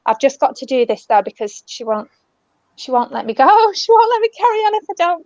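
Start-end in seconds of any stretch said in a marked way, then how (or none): 3.4-5.2 laughing. Real, not acted. Medium laughing at the spirit's insistence.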